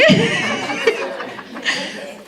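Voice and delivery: a long breathy laugh; voiceless laugh. Real, not acted. Teacher laughing at the retold scene, breathy laugh. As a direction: laugh quietly at the memory.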